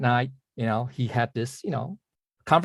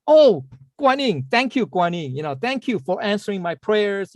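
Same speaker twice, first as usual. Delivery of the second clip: mock grateful exclamation; 6 semitones higher than usual. Poor man thanking the deity for found money, lightly acted gratitude.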